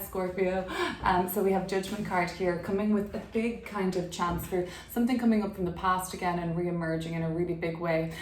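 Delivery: usual speaking voice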